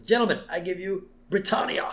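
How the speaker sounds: high-pitched British showman; British accent. Retold or imitated. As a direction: present it like a proud British impresario. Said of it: "the first is a British man".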